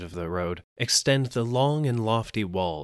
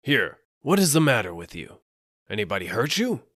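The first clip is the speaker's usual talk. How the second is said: louder, blunt demand; gruff questioning; 4 semitones higher, 5 dB louder than usual. Acted. Soba seller bluntly asks the panicked man what happened. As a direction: ask what's wrong, no patience.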